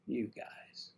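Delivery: very soft, trailing mock hurt; hushed little protest; hurt reaction. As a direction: drop to a near-murmur, wounded. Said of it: "And I'm like".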